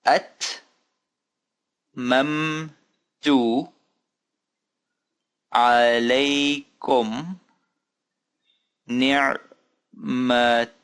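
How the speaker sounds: each syllable held and set apart by pauses; slow careful reading. Shown as a demonstration. Teacher reading for learners to follow along, slow and drawn-out. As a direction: read it out very slowly, piece by piece. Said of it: "notice me pronouncing them".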